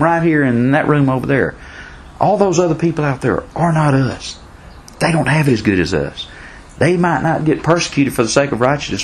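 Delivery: high, driving preacher voice; fired-up preaching. Acted. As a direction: preach it with rising fervor.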